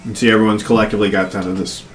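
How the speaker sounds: ordinary delivery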